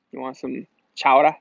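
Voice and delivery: mock New England, R dropped from chowder; New England accent. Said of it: "terrible flashbacks of New England".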